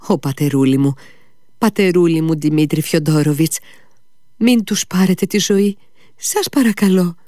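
high, loud begging wail; desperate pleading cry. Acted. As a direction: throw yourself at his feet and beg. Fenya keeps begging at his feet.

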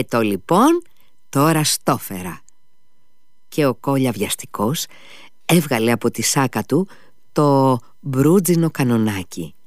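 usual speaking voice

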